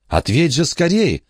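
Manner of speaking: high, eager cry to answer the phone; urgent exclaim. Acted. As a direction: urge him to pick up now. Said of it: "воскликнула Бронвин".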